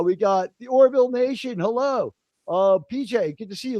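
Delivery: ordinary talk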